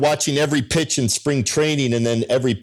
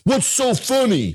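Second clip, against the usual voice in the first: high, slow mocking quote of a sportscaster; Stuart Scott; 10 semitones higher than usual. Sportscaster asking the giggling friends, high and slow. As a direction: pitch way up and drag it out like a snooty TV host. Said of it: "And Stuart Scott says".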